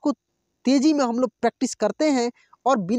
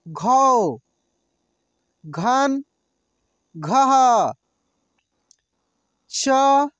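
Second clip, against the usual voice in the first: slow drill recitation; much slower than usual. Shown as a demonstration. Teacher reciting syllables for students to repeat aloud. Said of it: "यहाँ से हम लोग देखते हैं".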